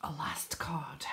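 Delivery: very soft, breath-only whisper; hushed echo of an announcement. Real, not acted. Repeats it in a whisper.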